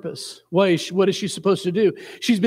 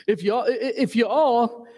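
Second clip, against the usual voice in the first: fumbled Southern drawl, pitched higher; attempted Southern drawl; 5 semitones higher than usual. Shown as a demonstration. Indiana preacher retrying Southern for the congregation, badly faked drawl. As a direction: stumble through a bad Southern drawl, amused. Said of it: "we can say that in the Southern drawl".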